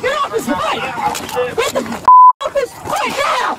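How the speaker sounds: high, slow desperate yelling; screaming. Real, not acted. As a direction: scream it out, dragging words. Driver screams during arrest.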